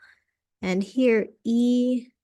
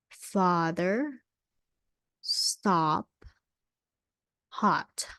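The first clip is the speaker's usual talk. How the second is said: ordinary talk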